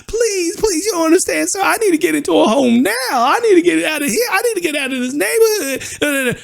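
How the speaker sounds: put-on high, pleading old woman's voice, close to tears; panicky old lady. To the housing agent, a frightened old lady begging to move. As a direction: imitate a frightened elderly caller who needs to move.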